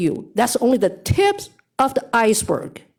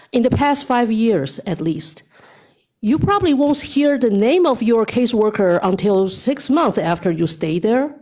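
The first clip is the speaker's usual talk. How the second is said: normal talking voice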